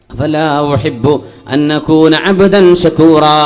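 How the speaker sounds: slow, sung Arabic chant; melodic Arabic recitation. Acted. Preacher chanting the Prophet's reply to the congregation, slow and sung. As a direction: intone it slowly like a recited hadith.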